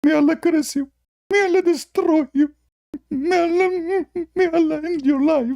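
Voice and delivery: very high, wailing curse; hammed-up crying curse; mock wailing curses. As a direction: whine out the curses like a crying man. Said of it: "he started crying".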